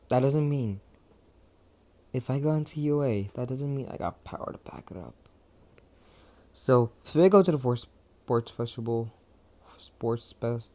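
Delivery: ordinary talk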